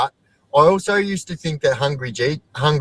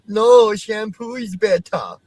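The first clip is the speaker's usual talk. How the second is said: slower mock-indignant retort, pitched higher; Billy Madison quote; 9 semitones higher, slower than usual. Quoting the Billy Madison reply to chat, slow mock retort. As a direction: snap back in a hammed-up comeback. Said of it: "Little bit of a Billy Madison fucking reference".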